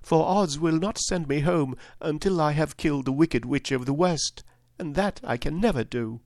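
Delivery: put-on high-pitched child's lament; a touch of hopelessness; sad. Dorothy tells friends of the impossible task, sadly. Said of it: "she said sadly".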